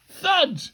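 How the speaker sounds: high, loud spoken thud; comic-book landing effect; imitated thud. A narrator's reading. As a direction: punch the word like a body landing. Teacher hitting the ground.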